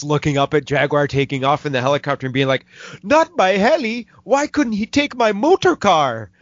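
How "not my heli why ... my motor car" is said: put-on high, whiny goofy villain accent; Donald Pleasance goofy accent. Dictator moaning as hero steals his helicopter, goofy accent. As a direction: moan it in a silly put-on European accent. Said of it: "really goofy fucking accent".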